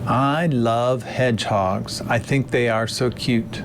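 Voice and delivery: played-back lecture audio; recorded original. Shown as a demonstration. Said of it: "Travis will play the original audio".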